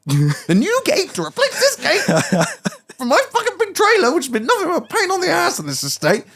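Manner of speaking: high, incredulous, laughing complaint; retold exasperated rant. Explaining the gate to a neighbour, high exasperated rant. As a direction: build up to a high comic outrage.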